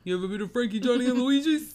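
put-on loud, high Italian-American accent. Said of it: "This is very stereotypical Italian".

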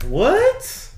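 very high, rising squeak of confusion; mock bafflement. Baffled by how house utilities work, exaggerated surprise. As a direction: shoot way up high in comic disbelief.

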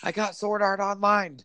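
high-pitched mock panicked-gamer yell; imitated yelling. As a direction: yell it high, imitating someone running round the house. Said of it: "running around in your house yelling".

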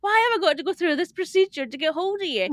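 loud, high mock-exasperated complaint; exasperated rant. Guest voicing her sister's complaint about silent phone, exasperated.

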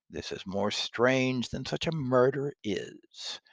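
loud, haunted Macbeth; hammy stage actor. Acted. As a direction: rage then wonder at the dead rising. Macbeth speech read aloud.

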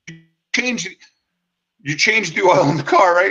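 can't believe what he's hearing; retold incredulity. Friends incredulous at no oil change.

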